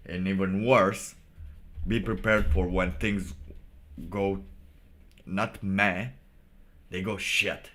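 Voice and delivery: normal talking voice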